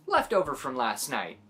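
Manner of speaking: plain reading voice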